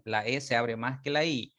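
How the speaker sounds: ordinary talk, nothing marked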